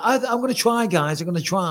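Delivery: lightly voiced whiny promise, high at first; a hint of mock pleading; mocking co-host. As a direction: tease him as an unreliable promiser. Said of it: "You're like".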